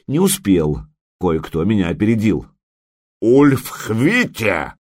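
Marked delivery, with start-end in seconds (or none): 3.2-4.7 slow barked name, a touch of a growl; a mock bark of the name. A narrator's reading. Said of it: "рявкнул медвежонок".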